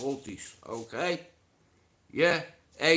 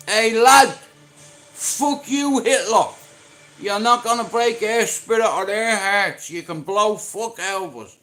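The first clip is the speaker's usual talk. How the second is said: loud and higher all through, roaring from the rooftop; defiant shout; 7 semitones higher, 9 dB louder than usual. Retold or imitated. Scousers on the roof shouting at Hitler, loud defiant roar. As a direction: yell it up at the planes. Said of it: "they're going up on the roof saying".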